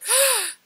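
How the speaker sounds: high, audible gasp of amazement; sharp gasp. Retold or imitated. Retelling arriving back in the chair, amazed gasp. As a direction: suck in a startled breath. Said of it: "and I was like".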